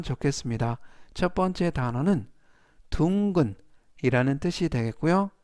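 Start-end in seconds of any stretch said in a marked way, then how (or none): none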